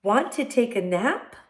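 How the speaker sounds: cat character. A narrator's reading. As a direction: ask with a hint of the cat's high voice. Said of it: "cried the cat".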